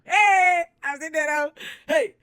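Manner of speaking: high, loud celebratory yell; hyped shout. Real, not acted. Celebrating getting in, a high loud yell. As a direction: cheer it like a party.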